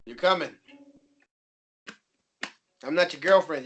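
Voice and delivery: ordinary delivery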